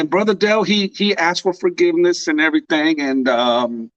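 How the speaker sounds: ordinary delivery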